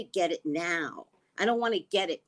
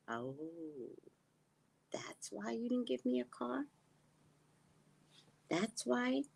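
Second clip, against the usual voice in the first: hushed realization; 5 semitones higher, 11 dB quieter than usual. Retold or imitated. Sudden realization about the car.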